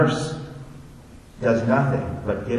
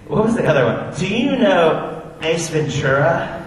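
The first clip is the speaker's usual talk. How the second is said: put-on eager child; 6 semitones higher, 5 dB louder than usual. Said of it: "I go".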